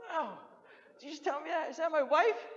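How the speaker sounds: shocked husband, voice leaping up; incredulous Job. Retold or imitated. Job reacts to his wife telling him to die, stunned and high.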